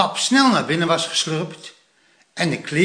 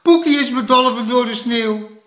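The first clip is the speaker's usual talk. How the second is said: high, urgent shout; loud alarmed call; 8 semitones higher, 5 dB louder than usual. A narrator's reading. Plop reporting his buried little brother, loud alarmed call. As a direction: raise the alarm loudly. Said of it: "riep Plop hard".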